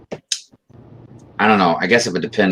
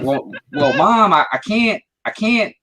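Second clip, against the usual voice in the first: high, mock-whiny child's excuse; kid protesting to mom; 14 semitones higher, 3 dB louder than usual. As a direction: plead with mom as a pretend kid, pitched up. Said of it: "Be like".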